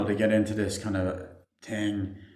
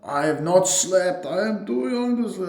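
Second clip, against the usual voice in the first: Alexi; 9 semitones higher, 3 dB louder than usual. Retold or imitated. Alexi answering about sleep while driving, hammed-up high protest. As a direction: imitate him protesting, pitched way up. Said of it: "Alexi, if you slept, he's like".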